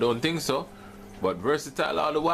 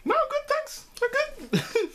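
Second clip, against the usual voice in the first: strained high voice; slower than usual. Real, not acted. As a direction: voice pinched high from the heat. Burning mouth, high strained voice.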